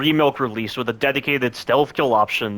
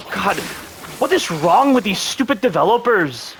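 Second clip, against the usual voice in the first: higher, incredulous frustration; exasperated; 7 semitones higher than usual. Real, not acted. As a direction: despair at the developers. Ranting at the developers.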